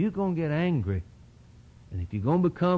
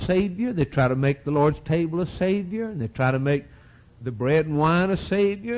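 usual speaking voice

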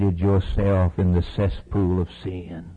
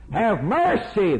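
pleading cry; 8 semitones higher, slower than usual. Acted. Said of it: "And he cried out".

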